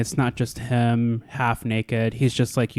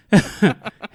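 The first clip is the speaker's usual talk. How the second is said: high burst of laughter; 9 semitones higher, 5 dB louder than usual. Real, not acted. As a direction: squeal of a laugh. Laughs at the name.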